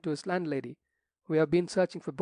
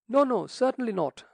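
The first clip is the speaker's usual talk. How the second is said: higher, louder fussy protest; fussy cry; 7 semitones higher, 5 dB louder than usual. A narrator's reading. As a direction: fluster and cry out in denial. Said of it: "cried the rector, rising fussily".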